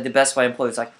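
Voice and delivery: normal talking voice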